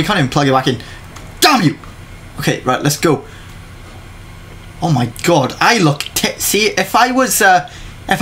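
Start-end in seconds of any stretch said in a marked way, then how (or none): none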